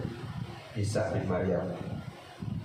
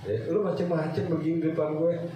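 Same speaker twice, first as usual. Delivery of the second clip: thug taunting; 6 semitones higher than usual. Retold or imitated. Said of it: "Ya, itu ngeledek".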